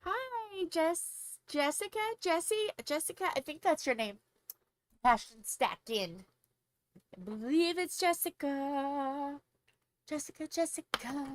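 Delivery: high, bubbly greeting; wired and chirpy; too much caffeine. Real, not acted. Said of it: "I am very hyper on coffee".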